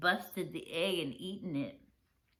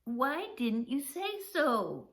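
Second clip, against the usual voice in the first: excited father; 4 semitones higher, slower than usual. Acted. Pop excited about hatching.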